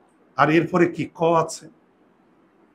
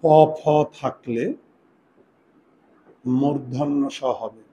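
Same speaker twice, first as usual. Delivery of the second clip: slow, spaced dictation for writing down; slow dictation pace; 2 semitones lower, slower than usual. Shown as a demonstration. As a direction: dictate each part slowly so they can write. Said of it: "লিখো।".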